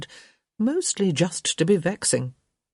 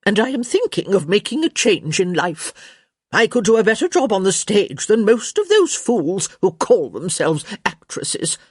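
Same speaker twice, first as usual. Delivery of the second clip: imperious dowager, raised and emphatic; sharp tenor; 4 semitones higher, 4 dB louder than usual. A narrator's reading. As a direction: declare it with haughty disdain. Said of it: "her voice returning to its usual sharp tenor".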